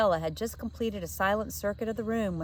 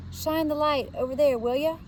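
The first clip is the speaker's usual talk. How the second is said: cockney lad; 7 semitones higher, 4 dB louder than usual. A narrator's reading. Jack asks for torch.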